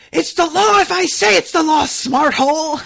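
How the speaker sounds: loud, high angry outburst; flipping out. Retold or imitated. Irate shopkeeper overruling a girl, flipping out at full volume. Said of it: "this dude flips out".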